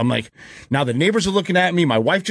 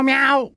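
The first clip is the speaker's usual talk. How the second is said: imitated cat meow; 9 semitones higher than usual. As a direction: mimic a small cat calling meow. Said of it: "I hear a fucking meow".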